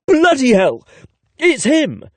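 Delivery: high, loud startled exclamation; shocked outburst. A narrator's reading. Narrator sees the surprise email, shocked shout. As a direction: blurt out in shock.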